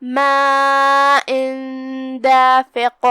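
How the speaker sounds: ماء long, tanween hidden into دافق with ghunna; ikhfa of tanween. Shown as a demonstration. Teacher demonstrating hidden tanween, slow recitation. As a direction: glide ماء into دافق with a nasal hum. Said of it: "بغیر ظاہر کیے ہوئے لیکن غنہ کے ساتھ".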